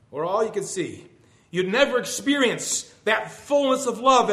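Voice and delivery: fervent recitation in God's voice, slowing toward the end; impassioned poem reading. Acted. God answering the weeping believer in verse, fervent recitation.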